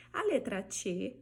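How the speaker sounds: ordinary delivery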